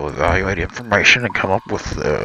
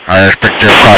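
loud, smothered too close to the mic; muffled on the mic; 8 dB louder than usual. Pretend. Co-host answering a complaint about his mic, muffled and loud. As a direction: crowd the mic till the words blur. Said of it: "You speak too close to the mic".

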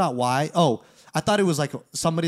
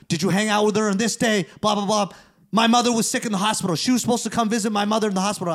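higher, louder, fast imitated grievance; agitated interrogation; 6 semitones higher, 5 dB louder, faster than usual. Jealous boyfriend grills the storyteller about his girlfriend, imitated agitation. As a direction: rattle off the jealous accusations, hammed-up.

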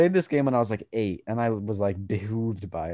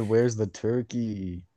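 slow punchline; much slower than usual. Retold or imitated. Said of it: "I said".

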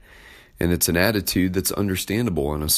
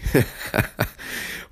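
breathy laugh; 6 semitones higher than usual. Real, not acted. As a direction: laugh under your breath. Preacher laughs at the point.

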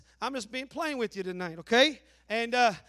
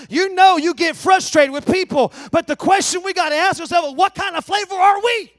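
much louder, higher, chanted preaching; full-force preaching; 7 semitones higher, 16 dB louder than usual. Acted. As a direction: drive the point home at full voice. Preaching climax.

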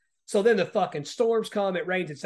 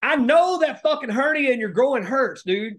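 loud, high, drawn-out retold reaction; emphatic mock exclamation; 8 semitones higher, 7 dB louder than usual. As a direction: exclaim it slowly, imitating an incredulous onlooker. Retelling an onlooker's emphatic reaction.

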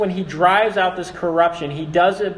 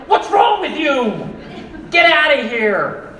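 high, loud, angry shout, drawn out; jealous husband shout; 8 semitones higher, 6 dB louder than usual. Acted. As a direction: run off the man hitting on your wife. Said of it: "A good husband is gonna say".

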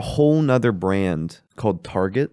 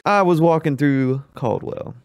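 mock stand-up bit; slower than usual. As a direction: stretch out a deliberately weak joke with long pauses.

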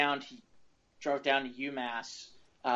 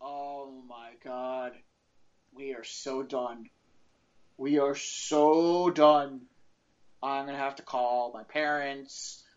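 slow, hushed, doomed muttering; sinking dread, dragging each word; panicked dread; 3 semitones lower, slower than usual. Retold or imitated. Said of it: "I'm like".

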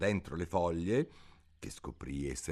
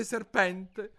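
high, rising, offended echo of serpente; indignant question; 14 semitones higher, 3 dB louder than usual. Acted. Alice echoes the pigeon's word, indignant. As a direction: toss back the word in disbelief. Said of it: "disse Alice indignata".

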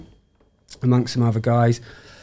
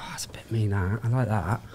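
softer, hushed aside; whispered to himself; 4 semitones lower, 9 dB quieter than usual. Retold or imitated. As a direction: breathe it as a secret wish. Quietly coveting the lead work.

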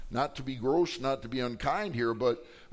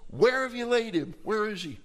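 raised, urgent questioning; troubled demand; 8 semitones higher, 4 dB louder than usual. Acted. Jesus asking mourners for his friend, troubled. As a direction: call out high and loud, troubled. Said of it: "He's troubled, and he said".

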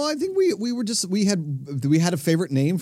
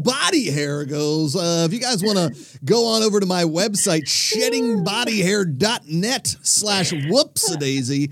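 higher-pitched showman's patter; hammed-up live TV host voice; mock announcer; 4 semitones higher, slower than usual. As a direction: present it like a ringside announcer. Mock announcer patter about own body hair.

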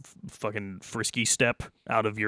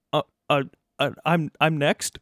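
higher, stammering scared man; horrified stammer; 6 semitones higher, 6 dB louder than usual. Acted. Terrified guard stammering back at Derek, higher and shaky. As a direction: stutter it out like a terrified worker. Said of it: "Horrified, the man says".

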